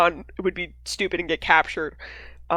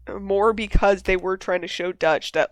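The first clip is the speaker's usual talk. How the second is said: ordinary talk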